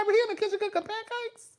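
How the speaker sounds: high, fast incredulous squeal; amazed question. Retold or imitated. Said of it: "I was like".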